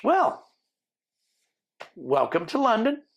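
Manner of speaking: imitating border officer. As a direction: put on the border lady's lilting welcome.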